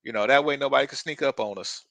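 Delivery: a hint of Bubba from Forrest Gump; Bubba impression. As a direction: lightly do the soldier talking to his friend. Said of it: "Bubba comes up and he said".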